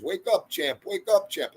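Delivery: high-pitched pleading, imitating a crying kid; mock tearful child's plea; mock bawling. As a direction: plead into a hammed-up wail. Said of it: "Just start bawling".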